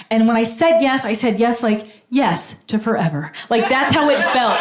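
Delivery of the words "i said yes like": normal talking voice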